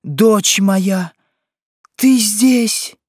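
high, loud astonished mother; crying out. A narrator's reading. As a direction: exclaim in shock, pitch climbing on the question. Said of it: "вскричала Тизон". (изумлённый вскрик матери)

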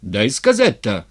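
loud, irritated cut-in; angered. A narrator's reading. Stepan demanding his say from Styr, angered. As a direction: demand to be heard, crossly. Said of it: "обозлился Степан".

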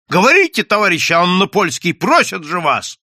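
loud, high, insistent demand; shouting. A narrator's reading. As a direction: cry out impatiently for an answer. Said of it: "закричал Костя".

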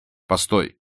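short call at normal volume; restrained call to stop. A narrator's reading. Vronsky stops Anna at the door, first restrained call. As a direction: stop someone without raising your voice.

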